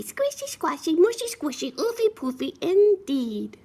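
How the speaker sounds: very high little piglet voice; little piggy. Acted. Little piggy agreeing about the mud, high piglet voice. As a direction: squeak it out as a tiny piglet. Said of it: "said little piggy".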